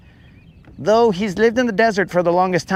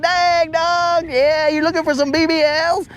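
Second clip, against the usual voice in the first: much higher, excited reply; excited self; 12 semitones higher than usual. Retold or imitated. Host greeting the alien, high excited voice. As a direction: jump up in pitch, thrilled. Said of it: "And I'm like".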